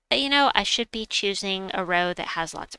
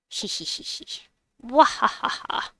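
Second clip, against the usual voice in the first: cartoon laugh; 4 semitones higher than usual. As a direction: giggle then burst into an evil laugh. Villainous cackle after scheming.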